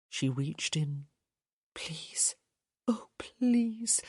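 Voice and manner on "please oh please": hushed, breathy begging; whispered pleading. A narrator's reading. Hyacinth reaching into the cavity, begging in a whisper. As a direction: pray for it under your breath.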